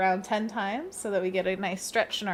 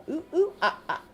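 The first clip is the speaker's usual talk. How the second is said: monkey noises; 8 semitones higher, faster than usual. Pretend. Monkey sound during stretch.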